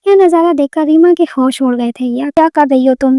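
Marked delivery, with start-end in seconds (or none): none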